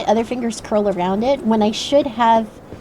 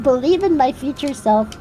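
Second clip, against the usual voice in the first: normal talking voice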